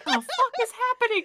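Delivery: high, laughing what-the-hell; laughing mock outrage. Friend voicing the character's shock, laughing high. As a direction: squeal it through a laugh. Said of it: "laughs, mock reaction".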